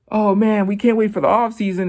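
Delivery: high, loud hype voice; eager DJ. Retold or imitated. DJ cheering for the new album, high and loud. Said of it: "and he's just like".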